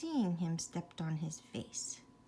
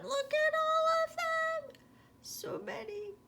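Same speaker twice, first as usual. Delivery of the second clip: much higher, amazed; excited. Real, not acted. Reader showing the child all the frogs, amazed. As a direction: point them out with delight.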